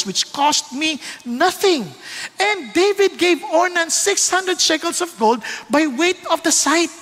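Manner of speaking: slow, high preaching delivery; emphatic scripture declamation. Acted. Preacher reads out David insisting on paying, slow emphatic declamation.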